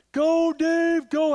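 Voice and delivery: high, loud cheering shout; crowd yelling. Retold or imitated. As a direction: yell it like a crowd cheering on a runner. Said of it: "everybody was yelling".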